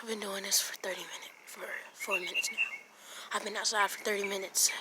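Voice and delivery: tired hushed whisper; whisper, worn out. Real, not acted. Whispering in the cold.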